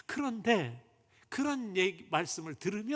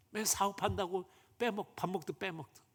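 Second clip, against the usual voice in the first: normal talking voice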